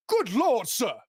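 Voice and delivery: high, loud flustered cries of sir; a shocked courier knocked flat, yelping; startled gentleman. Acted. GM voicing the knocked-down courier, high and alarmed. GM voices startled mail carrier.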